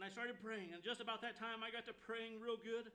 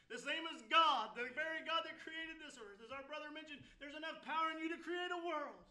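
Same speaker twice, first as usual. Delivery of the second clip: higher, louder revival preaching; climbing pulpit intensity; full-force preaching; 5 semitones higher, 5 dB louder than usual. Acted. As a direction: lift the congregation with rising fervour.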